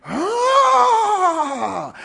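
sudden high startled shout; high-pitched scared holler; startled yelp. Retold or imitated. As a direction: yelp as if something jumped out at you. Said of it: "he's like".

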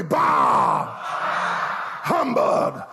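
drawn-out gruff "Bah!", then humbug; Scrooge. Acted. Preacher leads the church in Scrooge's line, gruff. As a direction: play the grumpy miser. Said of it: "Don't be a Scrooge".

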